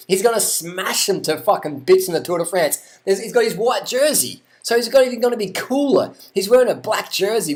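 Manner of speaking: high-pitched, excited rant; hyped-up prediction. Real, not acted. Fan predicting Froome will smash everyone, hyped-up rant. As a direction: build into a fan's fired-up boast.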